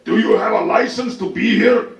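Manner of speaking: hammed-up indignant shout; old man screaming. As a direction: yell it like an irate old man. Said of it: "when people scream at me like that".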